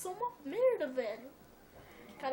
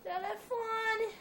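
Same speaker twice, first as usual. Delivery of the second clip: slow, stretched sing-song on each word; drawn-out sing-song; 2 semitones higher, 3 dB quieter, much slower than usual. Real, not acted. Gamer groaning at his phone ringing, slow sing-song. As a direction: sing out the phone's name lazily. Phone rings during gameplay.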